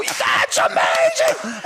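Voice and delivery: high, drawn-out cheer of amazement; excited yelling. Retold or imitated. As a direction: act out a wildly thrilled spectator. Retold cheering at horse show.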